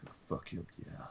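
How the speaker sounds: very soft creepy whisper; mock-menacing ASMR whisper. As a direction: whisper it right up close like a creep. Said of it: "really creepy A S M R".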